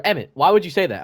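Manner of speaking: sharp, loud teacher demanding an answer; teacher snapping at a student across the room; scolding teacher. Retold or imitated. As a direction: call the kid out in front of class, loud and high. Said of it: "she just turns over".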